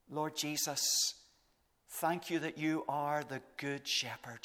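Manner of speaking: normal talking voice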